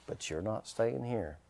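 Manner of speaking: soft, slow, final warning; quiet mock threat. Preacher telling the vole to leave, quiet mock menace. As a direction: drop right down for a playful ultimatum.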